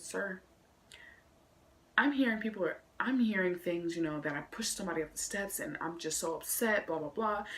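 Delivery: soft, deferential retold voice; hushed, halting mock-meek explanation; polite, upset report. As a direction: address the principal quietly and respectfully. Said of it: "I'm gonna say it exactly how I said".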